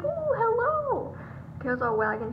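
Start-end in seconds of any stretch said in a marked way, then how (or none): 0.0-1.1 very high, drawn-out greeting; playful high voice for an excited dog. Acted. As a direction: squeal a slow hello as a thrilled dog. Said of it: "Beacon's really excited, so she's like".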